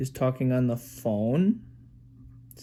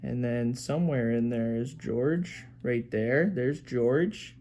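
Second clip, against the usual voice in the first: usual speaking voice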